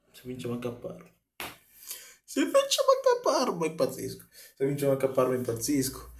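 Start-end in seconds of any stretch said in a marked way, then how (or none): 2.2-3.7 squeaky high excited voice; voce acutissima. Real, not acted. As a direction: squeal it in disbelief. (voce stridula di eccitazione)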